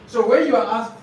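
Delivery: ordinary delivery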